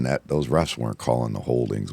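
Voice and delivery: ordinary talk, nothing marked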